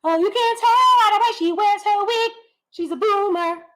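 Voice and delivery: mock commenter. Mocking a chat viewer who calls her a boomer. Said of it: "I can tell".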